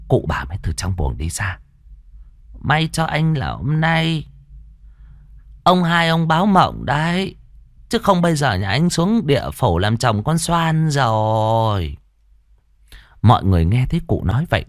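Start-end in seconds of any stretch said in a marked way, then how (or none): 2.6-12.0 put-on old great-grandmother.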